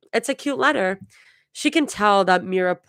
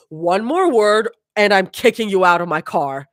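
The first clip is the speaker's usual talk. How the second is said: groaning; 2 semitones higher, 6 dB louder than usual. A narrator's reading. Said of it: "Mira groans".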